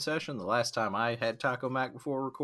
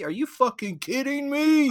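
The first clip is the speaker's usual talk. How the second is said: indignant disbelief; 13 semitones higher, 6 dB louder than usual. Real, not acted. Indignant at a trailer.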